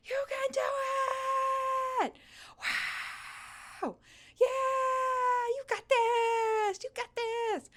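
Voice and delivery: high, stretched cheering yell; kindly screaming. Pretend. Host cheering on runners from behind, kindly screaming. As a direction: scream encouragement from behind like a proud fan. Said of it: "kindly screaming".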